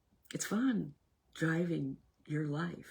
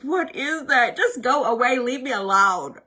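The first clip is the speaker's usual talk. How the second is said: irritated dismissal; 7 semitones higher, 11 dB louder than usual. Retold or imitated. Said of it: "I'd be like".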